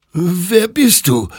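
high, drawn-out whimper. A narrator's reading. Hektor faces the unknown creature, whimpering. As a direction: whimper it slowly, high and loud. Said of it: "wimmerte Hektor".

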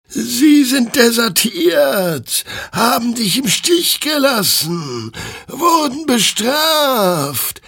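very high, slow eerie chant; chorus of flaming souls. A narrator's reading. Flaming souls explaining to Hector, slow and eerie. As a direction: speak as many souls at once. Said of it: "erklärten die flammenden Seelen ihm im Chor".

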